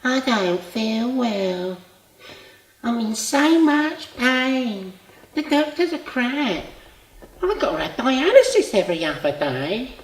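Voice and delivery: loud, high, slow drawn-out whine; mocking whinge. Old friend mimicking the sick caller's complaints, whingeing mockingly. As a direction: imitate a moaner, stretching out each complaint.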